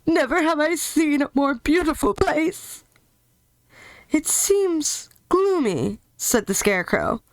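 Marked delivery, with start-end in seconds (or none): 0.1-2.8 raised joyful lion voice; lion gushing about the place; lion marvelling. Acted. 4.1-6.0 high, slow, drawn-out scarecrow voice. Acted.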